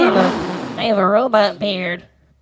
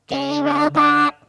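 synthetic robot voice, pitched up; a higher machine voice, evenly spoken; 8 semitones higher than usual. Shown as a demonstration. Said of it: "It's better".

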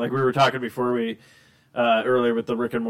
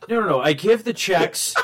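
ordinary delivery

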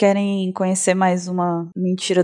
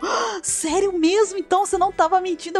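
amazed friend; 9 semitones higher than usual. Retold or imitated. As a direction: stretch it out like she finally believes. Friend convinced after father confirms.

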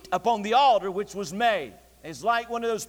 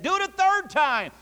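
high, emphatic command; called-out order; 9 semitones higher, 4 dB louder than usual. Retold or imitated. Elijah ordering a third soaking, called-out order. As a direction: raise the pitch for one more order.

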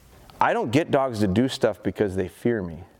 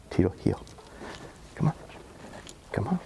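usual speaking voice

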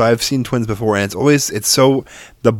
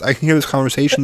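usual speaking voice

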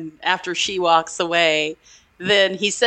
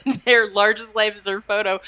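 normal talking voice

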